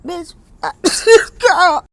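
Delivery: ordinary talk, nothing marked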